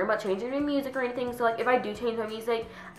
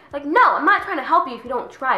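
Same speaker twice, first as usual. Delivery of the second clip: loud, high, emphatic rebuff; indignant refusal; 7 semitones higher, 7 dB louder than usual. Acted. To classmate who never tries, indignant. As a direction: shoot the moocher down, voice raised.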